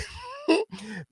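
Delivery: shrill burst of laughter; high squealing laugh. Real, not acted. Laughing hard at her own rant about resisting arrest.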